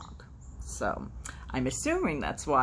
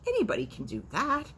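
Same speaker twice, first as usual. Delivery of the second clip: scoffing cow; 10 semitones higher than usual. Acted.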